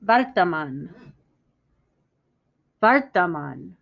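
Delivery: "Vartaman." said slowly and clearly, VAR-ta-man with a rolled r and open a's; careful Hindi word. Shown as a demonstration. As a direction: model the Hindi "Vartaman." syllable by syllable. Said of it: "listen to me say it carefully".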